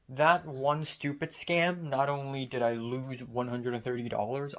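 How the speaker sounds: normal talking voice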